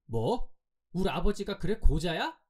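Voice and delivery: loud, high-pitched indignation; outraged retort. A narrator's reading. Boy answering an insult to his father, outraged. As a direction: snap back in outrage, pitch and volume up.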